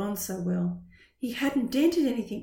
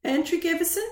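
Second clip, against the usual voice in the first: high rising call of Antrick Everson; receptionist calling out; 7 semitones higher, 4 dB louder than usual. Acted. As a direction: call the name up into a question. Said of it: "intoned the receptionist".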